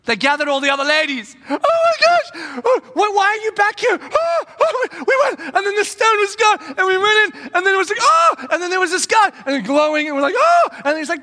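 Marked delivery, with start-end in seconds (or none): 1.5-8.5 very high, loud gushing; breathless excitement. Pretend. Women bursting in to tell the other ladies, in a high loud breathless gush. 10.3-10.7 very high, loud astonished cry; gasping oh. Pretend. The women seeing the glowing angel, with a high loud gasp.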